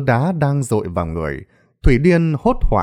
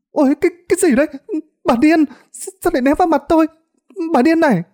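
put-on high, stammering boy's protest; alarmed boy; much faster than usual. A narrator's reading. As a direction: yelp in shock like a disgusted boy. Boy shouts at madwoman throwing filth. (giọng thằng bé the thé, hoảng hốt)

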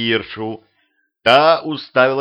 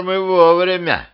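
put-on tipsy old man; 8 semitones higher, 5 dB louder than usual. Drunk grandpa, hiccups.

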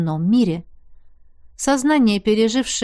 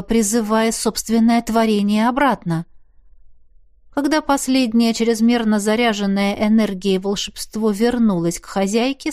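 ordinary delivery, nothing marked

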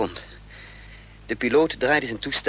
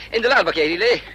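higher and louder, hurried order; urgent call; 5 semitones higher, 6 dB louder than usual. Acted. Hero urging Lillet into the truck while fleeing: urgent loud call. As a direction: shout her into the truck bed.

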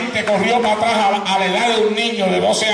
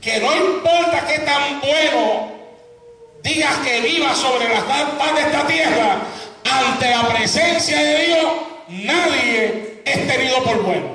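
emphatic preaching; 6 semitones higher than usual. Acted. Preacher tells congregation none are good before God, emphatic preaching. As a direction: drive each phrase home from the pulpit.

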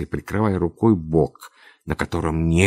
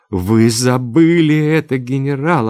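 high, louder, distraught protest; sobbing reply; 7 semitones higher, 7 dB louder than usual. A narrator's reading. Olga struggling in the general's arms, tearful protest. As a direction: voice raised through acted tears. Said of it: "отвечала Ольга, рыдая".

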